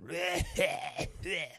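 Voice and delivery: hacking cough, mimicking a sick passenger; mock a gross, phlegmy hack; put-on sick man's cough; imitated phlegmy cough. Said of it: "he went".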